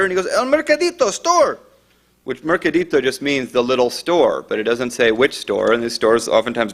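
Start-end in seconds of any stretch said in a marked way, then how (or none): 0.3-1.6 put-on very high, urgent Spanish-accented cry; imitated panicked caller. Second caller tells dispatcher the store, imitated panic. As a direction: cry out the place with pitch way up.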